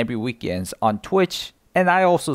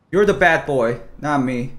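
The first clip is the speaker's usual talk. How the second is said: normal talking voice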